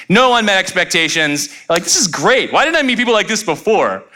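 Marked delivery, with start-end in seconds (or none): 1.8-4.0 high, swooping mock enthusiasm; gushing delight. Someone delighted by a brand new friend, gushing. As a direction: gush as if smitten with a new pal.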